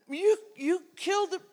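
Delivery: high, stammering amazement; mock disbelief. Grandfather to grandson about killing bears, playfully incredulous.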